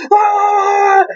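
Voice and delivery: high, drawn-out imitated scream; high-pitched crazed shout, exaggerated; mock raging yell. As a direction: let out a hammed-up roar of outrage. Acting out De Niro ranting.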